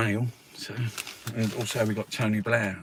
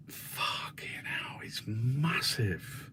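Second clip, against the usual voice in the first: slow, hushed whisper of amazement; awed whisper; 3 semitones higher, 7 dB quieter, much slower than usual. Retold or imitated. Imitating oil discoverers in awe, slow whispered. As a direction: whisper it like seeing something huge. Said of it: "it was like".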